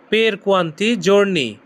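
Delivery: "Per quanti giorni" said very slowly as one phrase; very slow teacher's model of "Per quanti giorni"; very slow demo. Shown as a demonstration. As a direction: drag out every syllable of "Per quanti giorni". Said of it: "কতদিন খাবো এর Italian কি হবে?".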